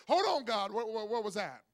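loud startled outcry; shocked exclamation. Retold or imitated. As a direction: sound blindsided by the news. Surprise fourth child.

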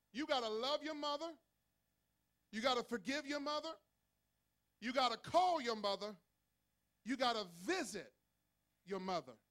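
loud, high, hammering each "gotta"; preaching at full force. Acted. Pastor urging the church to love their mothers. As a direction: charge them like a revival call.

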